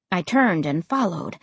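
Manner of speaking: usual speaking voice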